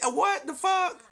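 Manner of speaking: high, stunned outburst; shocked. Real, not acted. Streamer stunned on reading a follower's name, high disbelief. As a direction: jump up in disbelief.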